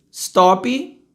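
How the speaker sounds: "stop" as "stoppy", rising; Brazilian pronunciation. Shown as a demonstration. Teacher mimicking Brazilians, "stop" with final i. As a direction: add "ee" after the p of "stop". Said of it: "Brasileiro, né?".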